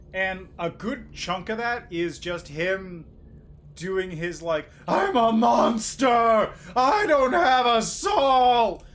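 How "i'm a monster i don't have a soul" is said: loud, slow, anguished villain cry; dramatic monster speech. Retold or imitated. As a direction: shout it like a tortured soul.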